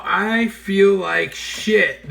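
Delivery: slow, hoarse, miserable hangover; groggy hungover voice. Retold or imitated. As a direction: drag it out like a hangover. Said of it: "That was his exact words".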